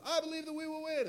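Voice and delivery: high-pitched sustained mock chant; rallying cry held up high; softball cheer. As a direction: imitate a girl leading the team cheer. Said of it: "they do this little cheer".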